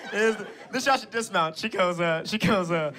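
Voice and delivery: normal talking voice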